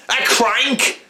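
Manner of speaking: high, affronted echo of the insult; mock indignant crank. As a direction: pretend outrage at being called a name. Acted pub confrontation.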